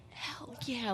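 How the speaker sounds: soft whispered hell yeah; whispered crowd cheer. Retold or imitated. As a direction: breathe out the cheer like a hushed fan. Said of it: "Everyone there was like".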